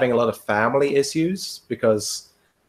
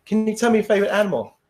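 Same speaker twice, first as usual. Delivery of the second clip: fast, higher rote examiner question; a worn-out examiner on autopilot, fast; bored, rattled-off question; 10 semitones higher, much faster than usual. Retold or imitated. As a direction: rattle it off, numb from repetition. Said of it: "examiner repeating".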